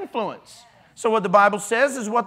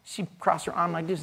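ordinary talk, nothing marked

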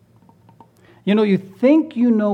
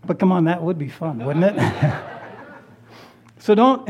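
ordinary talk

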